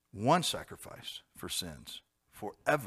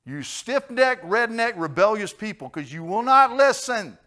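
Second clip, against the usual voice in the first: loud, high, furious scolding; Paul's angry rebuke; 8 semitones higher, 13 dB louder than usual. Retold or imitated. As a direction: rail at a stubborn people. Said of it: "Paul said".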